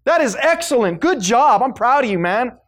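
mocking the people who congratulate an addict; mock cheering praise. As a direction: gush over the addict with fake pride, scornfully. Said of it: "that's like telling the drug addict".

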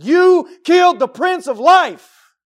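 very loud, high and slow accusation; full-force preacher's yell; accusing shout. Retold or imitated. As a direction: thunder it at a crowd, word by word. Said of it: "if he said it exactly like that".

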